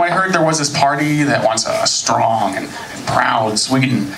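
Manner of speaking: ordinary delivery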